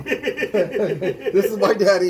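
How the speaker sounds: breaking into laughter mid-line; slow and laughing, can't hold it in; cracking up at his own punchline; laughing through the line. Real, not acted. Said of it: "I love that line".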